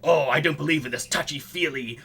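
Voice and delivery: ordinary talk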